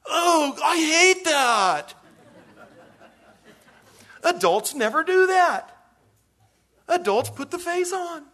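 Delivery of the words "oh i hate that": high, loud, slow whine of disgust; whiny disgusted complaint. Acted. Guest complaining about the served food, high slow whine. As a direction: drag it out like a guest hating the food. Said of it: "and say".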